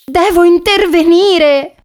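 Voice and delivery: high, drawn-out resolve; alarmed little dog. A narrator's reading. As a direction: declare it high and slowly, as Lilly. Lilly resolves to stop the rat. (cagnetta allarmata, voce acuta e decisa)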